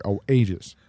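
ordinary talk, nothing marked

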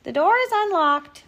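storybook grandmother's high reply; Granny calling out. A narrator's reading. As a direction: call through the door like a granny. Granny answers the visitor.

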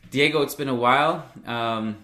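ordinary talk

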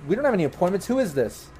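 lightly voiced confusion at an unexpected visitor; mildly startled nurse. Nurse at the door faces a stranger, mildly startled.

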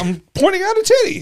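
very high, loud imitation of the brother; imitating the brother insisting, very high and loud; mock indignant brother. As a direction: squeak it out, offended. Imitating the brother defending himself.

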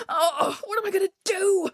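high, fast, plaintive cry; panicked wail. A narrator's reading. Coyote trapped inside the tree, panicked wailing. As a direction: wail it in a helpless rush.

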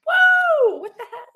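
high laughing yelp of surprise; sudden shocked whoa breaking into a laugh; startled whoa. Retold or imitated. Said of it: "you're like".

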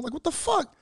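sudden high shock; startled high exclaim. Retold or imitated. Shocked at son.

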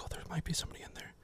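hushed, quick whisper of someone scared of who is hiding; nervous whisper. Retold or imitated. Friends creeping up to a closet, whispering nervously. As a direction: breathe it fast, afraid to be heard. Tense search, checking the closet.

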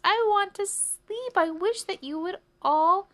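slow, high, loud wail; shouted plea. A narrator's reading. Sleepless mouse shouts at the crickets, desperate. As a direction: hammer out each word, climbing to the end. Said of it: "shouted the mouse".

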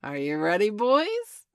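loud call swooping high at the end; rally the gang cheerfully; merry shouted question. A narrator's reading.

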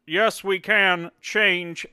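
slow, high Obama impression; hammed-up Obama speech line; Barack Obama. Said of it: "Barack Obama".

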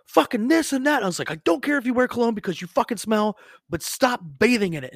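high-pitched re-enacted tirade, easing slightly by the end; imitated angry chewing-out; screaming rant. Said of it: "I'm just fucking screaming at this dude".

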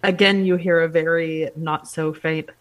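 normal talking voice

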